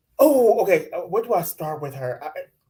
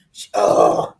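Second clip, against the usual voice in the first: disgusted ugh; 8 dB louder than usual. Real, not acted. Disgusted groan.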